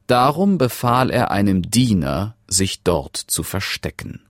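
ordinary delivery

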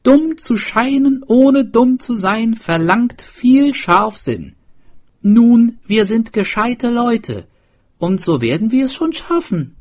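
high, loud character voice; swineherd. A narrator's reading. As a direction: argue the plan with conviction. Swineherd continues his plan.

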